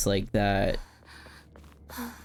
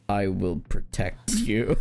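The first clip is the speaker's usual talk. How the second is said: lower, slow, mock gallant promise; 5 semitones lower, slower than usual. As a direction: lightly play the heartthrob protector. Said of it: "And then [name] replies".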